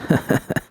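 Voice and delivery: a soft airless chuckle; small breathy laugh. Acted. V laughs softly at Judy's eagerness, small breathy laugh. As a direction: let out a quiet little laugh. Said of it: "V allowed herself a small laugh".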